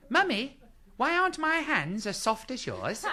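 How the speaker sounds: put-on high, slow, sweet child's voice; mock child in an advert. Comedian mocks a TV advert, as a sweet child. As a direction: play a cute kid from a TV ad. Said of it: "Who writes those commercials".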